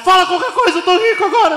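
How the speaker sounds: very high excited voice; elated boasting. Acted. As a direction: crow it like you're suddenly rich. Ricardo elated, believes he is rich.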